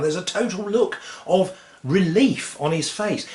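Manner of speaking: ordinary delivery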